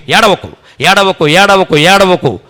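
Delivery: Krishna telling Arjuna not to weep, loudly; emphatic consoling. Acted. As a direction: repeat it firmly, comforting.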